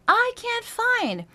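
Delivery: very high, slow spoken line; high speech placement. Shown as a demonstration. Voice coach speaking high to find head voice. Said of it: "tricking your system into just going into these higher places".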